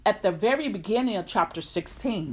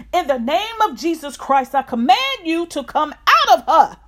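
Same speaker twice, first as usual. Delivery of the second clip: high, loud command; 8 semitones higher, 8 dB louder than usual. Retold or imitated. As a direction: cast it out with authority. Paul commands the spirit.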